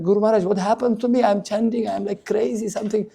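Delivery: high, fast, breathless with wonder; a young disciple bursting to his guru, high and fast; excited. Acted. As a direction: tumble out the words in ecstatic confusion. Young Nimai excitedly asks guru.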